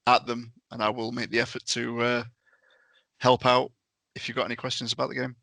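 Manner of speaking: ordinary talk, nothing marked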